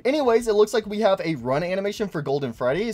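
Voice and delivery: ordinary talk, nothing marked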